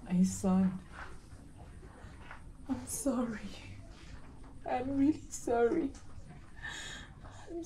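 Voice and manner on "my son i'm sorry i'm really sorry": breathy whisper of sorry; faint dying whisper; whispered apology. Acted. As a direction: whisper it, barely there. Dying mother apologises to son.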